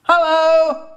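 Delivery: high, loud sing-song hello; calling out. Retold or imitated. Mum arriving home, calling a high loud hello. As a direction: call it out from the front door. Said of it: "my mum calls out".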